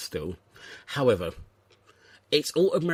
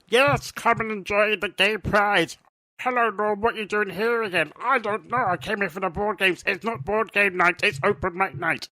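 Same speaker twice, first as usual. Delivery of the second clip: much higher put-on volunteer voice; mock dialogue in a squeaky higher voice; 10 semitones higher, 4 dB louder than usual. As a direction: play a chirpy high-voiced volunteer greeting Norm. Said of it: "Stop talking like that".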